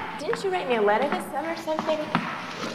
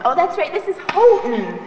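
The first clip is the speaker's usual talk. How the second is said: higher and louder, dripping with sarcasm; sarcastic realization; 3 semitones higher, 7 dB louder than usual. Acted. Spoiled roommate mocking the plain dorm, sarcastic. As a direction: act like you just remembered, with mock delight.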